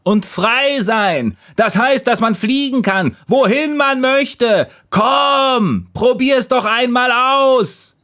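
excited, very high-pitched calling. A narrator's reading. Leaves daring the last leaf to fly, high eager call. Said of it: "riefen die anderen Blätter".

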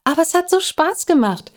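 put-on high, quick child's protest; squeaky rush of objection. A narrator's reading. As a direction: protest like a little girl, fast and high. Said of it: "protestierte Lissy".

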